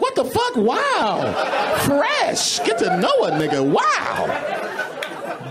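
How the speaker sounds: very high mock-scandalized gasp; shocked pearl-clutching.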